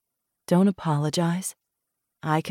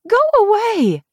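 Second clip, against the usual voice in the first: high shout; 7 dB louder than usual. Acted. Drunk colleague at her door.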